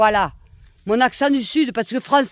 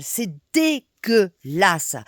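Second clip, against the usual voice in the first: "dégueulasse" broken into slow, separate syllables; emphatic disgust; 3 semitones lower, much slower than usual. Real, not acted. Telling Macron it's not normal, "dégueulasse" slowly spelled out in disgust.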